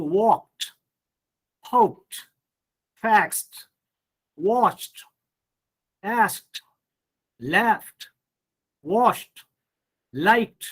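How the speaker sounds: "walked", "hoped", "laughed" each ending in t; voiceless t endings. Shown as a demonstration. Teacher modelling the rule for students, "washed" with t ending. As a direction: list each past tense separately, "liked" as "likt". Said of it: "after voiceless sound".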